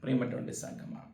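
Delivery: breathy hush, voice dropped right down; whispered aside. Real, not acted. Preacher whispering to the congregation to think. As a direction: drop to a whisper to make them think.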